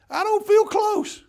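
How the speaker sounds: high, loud, drawn-out protest; mock alarm. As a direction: squeal it in comic unease. Nervous about police behind him.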